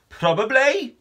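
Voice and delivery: high, incredulous echo; alarmed question. Acted. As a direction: throw the word back in disbelief. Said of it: "said Con".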